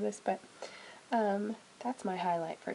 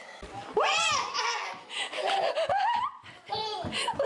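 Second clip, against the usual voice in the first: high delighted laugh; 4 dB louder than usual. Real, not acted. Playing with baby.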